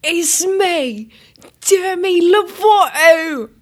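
high, loud, slow pop star voice. Pretend. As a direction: camp up a breathless celebrity. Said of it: "do your [name] impression".

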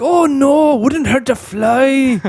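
very high, slow Scottish voice; loud high-pitched Glaswegian impression; Billy Connolly impression. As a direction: stretch it out in a squeaky Scottish brogue. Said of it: "That's really good".

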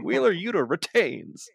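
high hammed-up ring announcement; mock announcer. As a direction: call it out like a goofy announcer. Announcer retold.

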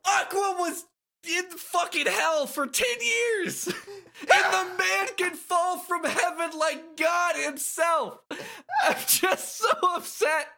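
high, loud, laughing outburst; outraged rant. Real, not acted. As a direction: rant at the absurd plot, shouting. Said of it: "I'm sorry for my outburst".

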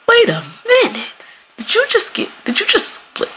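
high, stunned questioning; incredulous realization. Retold or imitated. Friend watching the blame flip, high stunned disbelief.

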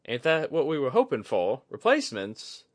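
high, loud, blunt question; Kudaka, puzzled. A narrator's reading. Kudaka questions Oguri's worry, blunt. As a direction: push back on his worry.

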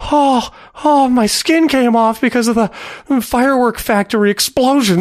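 lightly hammed-up burn victim; burn victim in pain. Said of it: "Ooh, a character piece".

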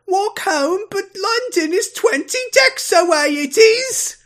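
very high, hammed-up British kid; British accent. Host imitating a boy dismayed at walking home, exaggerated British accent. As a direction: squeal it in a bad British accent. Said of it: "worse than the British accent".

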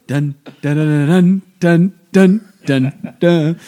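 loud, high chanted dun dun; drum roll. Retold or imitated. Mother imitating the procession drum roll, loud and high. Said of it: "drum roll thing".

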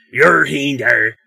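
woodcutter grunt. Retold or imitated. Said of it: "they make sounds ranging from".

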